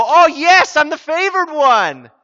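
high, loud, whooping celebration; hammed-up jubilant squeal; mock elation. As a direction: gush like an over-the-top lottery winner. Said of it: "So Mary didn't go".

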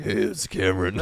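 jokey low-frequency introduction; mock low voice. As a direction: drop down from falsetto for a teasing imitation. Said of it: "bring our, uh, frequency down".

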